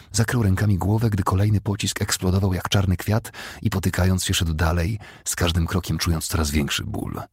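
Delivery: usual speaking voice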